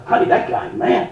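hushed aside to a wife, retold; private confiding. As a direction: confide it softly, almost without voice. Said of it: "you know, in private".

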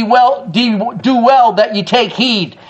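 ordinary delivery

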